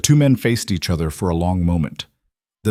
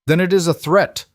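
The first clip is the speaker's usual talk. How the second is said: hard voice; 9 semitones higher than usual. A narrator's reading. Chief answering the army captain, hard and defiant. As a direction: make it a blunt warning, pushed up and out. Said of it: "Talon's voice was hard".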